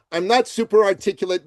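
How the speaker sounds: ordinary talk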